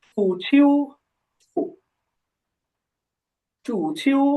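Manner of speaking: চু ছিউ as two distinct syllables with long pauses between repeats; pronunciation demo. Shown as a demonstration. Teacher giving the word for football, pronunciation demo of চু ছিউ. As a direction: model চু alone, then চু ছিউ. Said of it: "ফুটবলেরটা হবে যে".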